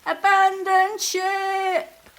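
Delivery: slow, loud, high bellow; yelled. A narrator's reading. Grandfather ordering the toys off the boats, slow loud yell. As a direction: bellow the order across the water. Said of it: "yelled Grandfather".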